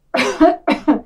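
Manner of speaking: short double fake cough; demonstrated cough. Shown as a demonstration. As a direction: cough twice to show what coughing is. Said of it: "This is coughing".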